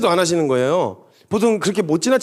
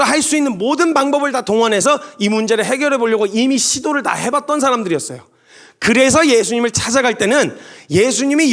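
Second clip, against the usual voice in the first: raised, driving sermon voice over music; preaching at full force; 5 semitones higher than usual. Acted. Pastor drives home his point to the congregation, preaching hard. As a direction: build the climax and press each line home.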